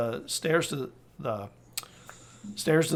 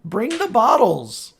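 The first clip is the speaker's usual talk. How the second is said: yell; 7 semitones higher, 7 dB louder than usual. Acted. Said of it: "she yells".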